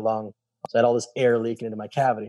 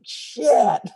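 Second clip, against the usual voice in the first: dismayed curse; 11 semitones higher, 4 dB louder than usual. Retold or imitated. Reaction to doctor's bad news.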